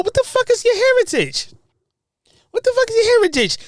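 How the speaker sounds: high, indignant repeated demand; scornful disbelief. Real, not acted. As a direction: pitch jumps up in outrage. Scoffing at the clip's claim.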